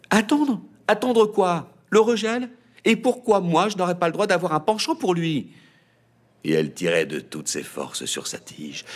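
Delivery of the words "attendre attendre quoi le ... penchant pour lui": put-on high, loud, fast indignant teen girl; girl voice pitched high in outrage. A narrator's reading. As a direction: protest like a stung young daughter. Daughter protests, high pitched.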